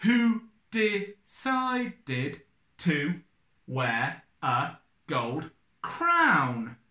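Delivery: very slow, syllables pulled apart; slow counting read. Shown as a demonstration. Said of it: "Nine syllables".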